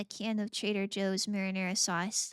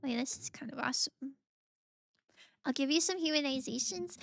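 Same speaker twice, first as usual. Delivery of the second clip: normal talking voice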